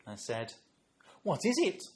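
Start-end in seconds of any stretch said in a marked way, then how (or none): 1.2-1.8 put-on high, loud question to a small child; talking down sweetly to a toddler; cooing curiosity. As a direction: ask with exaggerated interest. Grandad asking small child about craft.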